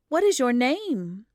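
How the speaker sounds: put-on gently questioning the lost child; a kindly townswoman asking; curious woman. A narrator's reading.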